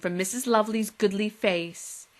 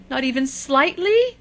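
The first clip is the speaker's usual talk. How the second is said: anxious; 5 semitones higher, 6 dB louder than usual. Acted. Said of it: "asked one of the menfolk anxiously".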